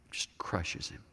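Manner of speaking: hushed whisper on crushes; whispered emphasis. Acted. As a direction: drop to a whisper to let it sink in. Repeating for weight.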